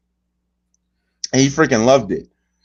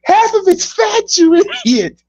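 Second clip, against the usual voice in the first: Gordon Ramsay rant; slower than usual. Retold or imitated. Imitating a chef yelling at a cook, hammed-up. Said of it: "my favorite Gordon Ramsay rant".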